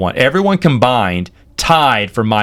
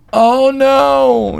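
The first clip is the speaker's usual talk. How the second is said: imitated high, drawn-out cry of dismay; mock-distraught wail, pitched way up; upset; 13 semitones higher than usual. Investments guy learning his referrer is leaving, upset. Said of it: "He was just so upset".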